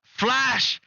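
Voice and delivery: high-pitched mock newsflash; Newsmax. Mocking a news channel alerting viewers about the French, alarmed announcer. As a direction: sound a hyped-up alarm, pitched well up. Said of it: "Newsmax would be like".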